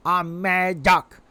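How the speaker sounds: slow, grumbling mad duck; grumpy duck voice. Pretend. Showing off the angry duck emote in a grumpy voice. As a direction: drag it out like a cranky duck.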